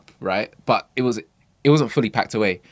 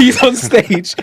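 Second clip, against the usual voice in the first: slow, higher, laughing it out; 14 semitones higher, 10 dB louder, much slower than usual. Real, not acted. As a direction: stretch it through a laugh. Laughing retelling.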